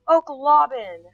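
high, loud cry of surprise; blurting out a name in alarm; surprised exclaim. Real, not acted. Sudden exclaim at game event.

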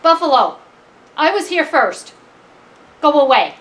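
indignant little challenger; defiant mouse. Acted. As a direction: stand your ground and order them off. Mouse confronts the buffalo.

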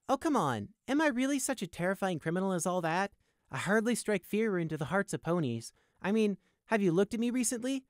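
cheeky, lilting self-defence; flippant. A narrator's reading. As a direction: play innocent, flippantly and high. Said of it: "Prey asked flippantly".

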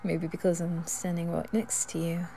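shy confession whispered close; a nervous whisper next to the listener. Acted. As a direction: whisper it, embarrassed to admit it. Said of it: "I'm so nervous".

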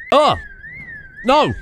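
sudden high yelp of alarm; startled shout. Real, not acted. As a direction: cry out as you're grabbed. Sudden attack in game.

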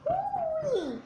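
high mock-angry exclamation; mad mom. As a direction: yelp it like a fed-up mother.

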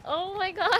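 ordinary talk

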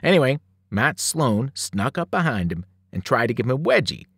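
usual speaking voice

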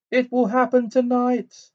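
a seer's harsh prophecy bursting out; loud harsh voice. A narrator's reading. Trelawney in a trance warning Harry, loud and high. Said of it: "a loud, harsh voice".

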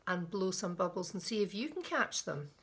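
ordinary delivery

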